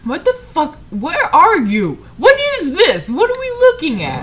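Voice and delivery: high, loud, incredulous questions; baffled outburst. Real, not acted. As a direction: demand answers in rising bewilderment.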